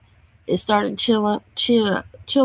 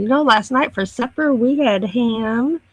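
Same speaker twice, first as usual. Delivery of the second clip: normal talking voice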